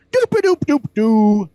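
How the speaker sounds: high, loud, slow scat "doop-a-doop"; sing-song the filler noise between jokes; scat noodle. Retold or imitated. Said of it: "Patton Oswalt used to go".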